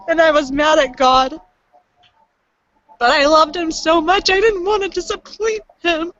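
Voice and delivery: fighting back tears while speaking; tearful. Real, not acted. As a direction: voice rising and tight with real tears. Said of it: "I'm just a blubbering mess".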